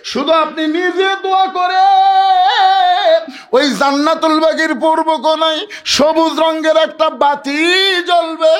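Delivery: very high, loud chanting cry; impassioned acted outcry. Fatema telling Ali where to bury her, impassioned outcry. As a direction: call out instructions in a high wail. Dying wife's burial instructions cried out.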